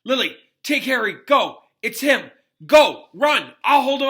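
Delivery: high, loud, frantic warning; father shouting for wife to flee, panicked. A narrator's reading. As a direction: scream at her to run. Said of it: "shouting panically".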